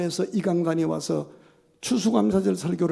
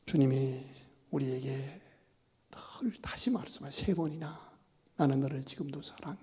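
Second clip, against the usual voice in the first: soft, near-whispered sermon lines; hushed preaching; 3 semitones lower, 10 dB quieter than usual. Acted. Pastor softly assures the congregation of love, hushed. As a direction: draw them in with a hush.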